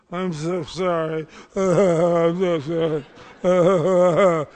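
loud, slow, drawn-out mock wail; blubbering drunk. Mocking the crying drunk doctor, loud slow mock wail. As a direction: ham up a weepy drunk's apology.

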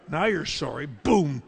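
sharp retort. Retold or imitated. Retort to the drunk.

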